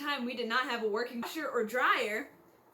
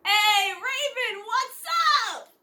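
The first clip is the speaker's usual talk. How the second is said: high, booming, drawn-out hello; loud greeting; 10 semitones higher, 9 dB louder, slower than usual. Acted. Greeted on entering the nurse's office, high loud holler. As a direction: holler it across the room.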